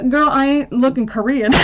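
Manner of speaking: amused, sassy and laughing. Real, not acted.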